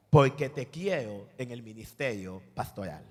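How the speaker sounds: God calling him, low and slow. Acted. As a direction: speak as God, deliberate. God answers the young man.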